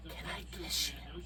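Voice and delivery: breathy creepy whisper in the ear. Retold or imitated. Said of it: "whispered it like a freak".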